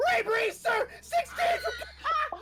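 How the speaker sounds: very high, loud cadet's scream; overexcited nervous scream. Acted. Over-excited cadet answering his officer, screaming nervously. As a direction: scream it back, too eager and nervous. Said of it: "screams back".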